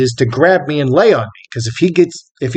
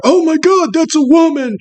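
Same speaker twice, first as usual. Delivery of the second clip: high-pitched, put-on shocked voice; mock horror; 13 semitones higher than usual. Onlookers after he grazed her, in high mock horror. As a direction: imitate overblown outrage, pitched way up. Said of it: "they acted like I was killing her".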